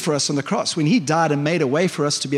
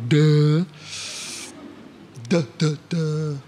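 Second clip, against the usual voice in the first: imitated "duh" noises; 2 semitones lower, much slower than usual. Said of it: "It's like God going".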